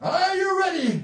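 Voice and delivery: high, shouting revival preacher; mock preacher working up the crowd; preaching.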